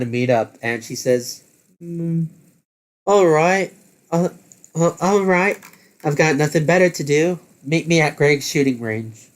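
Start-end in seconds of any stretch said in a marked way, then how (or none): 1.8-9.3 higher-pitched character voice, casually agreeing; Hane, casual crew member. Acted. Hane agrees on the phone to meet up, casual higher character voice.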